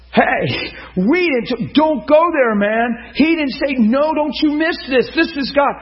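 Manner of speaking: high, loud, pleading cry of protest; impassioned shout. Retold or imitated. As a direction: shout it out, begging him not to throw it away. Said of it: "And Randy goes".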